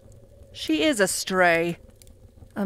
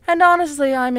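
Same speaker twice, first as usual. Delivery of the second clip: sudden outburst; 5 semitones higher, 10 dB louder, faster than usual. Acted.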